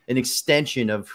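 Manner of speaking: ordinary talk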